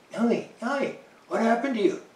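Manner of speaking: high, startled call to the dog; alarmed calling out. Retold or imitated. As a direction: call the dog's name in sudden alarm. Chris calls out to bleeding dog.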